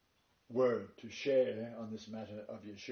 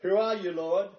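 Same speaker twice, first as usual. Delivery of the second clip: startled cry, higher and louder; gasped cry; 6 semitones higher, 13 dB louder than usual. Retold or imitated. Paul on the Damascus road, crying out startled. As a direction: let the shock burst out of Paul. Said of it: "he gasped".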